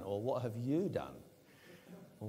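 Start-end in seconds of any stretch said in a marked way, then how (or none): 0.2-1.2 "you" pressed hard, pointing at him; stress on you. Shown as a demonstration. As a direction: put the weight on "you" in "What have you done?". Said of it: "put the emphasis wherever you like".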